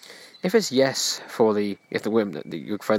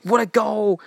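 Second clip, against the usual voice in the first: much higher, cheering mock fan voice; imitating excited football fan; 12 semitones higher, much slower than usual. Mocking men watching football, high excited cheering. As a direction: ham up a fan celebrating a goal. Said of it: "like, watching football".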